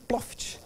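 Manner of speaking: soft little "ploft" effect; imitated flop sound. Client demonstrating his folding method, imitated soft flop. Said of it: "é só abrir que ela faz".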